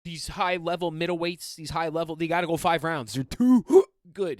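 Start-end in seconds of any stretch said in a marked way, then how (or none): 3.1-4.4 loud hiccup breaking the phrase; real hiccup. Real, not acted. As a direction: get cut off by a hiccup before the last word. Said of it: "getting hiccups 'cause I'm talking so fast".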